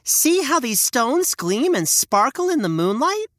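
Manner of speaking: put-on high, soothing little boy; a boy calming his sister; young boy voice. Hansel showing the stones.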